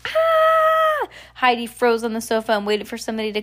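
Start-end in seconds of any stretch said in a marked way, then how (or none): 0.1-1.1 very high, loud held scream; long piercing "Ah!"; acted storybook shriek. A narrator's reading. Heidi screams again in story.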